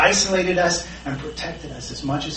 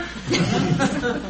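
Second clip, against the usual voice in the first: 1 short laugh; 10 semitones higher than usual. Real, not acted. Laughs after dad impression.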